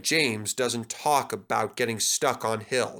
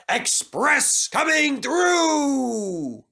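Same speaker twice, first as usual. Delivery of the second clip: slow, high call stretched out from far away; a long proud call fading round the bend; calling from afar; 13 semitones higher, 5 dB louder, much slower than usual. Acted. Gordon calling back to James, high and drawn out from far off. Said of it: "he could hear Gordon calling out".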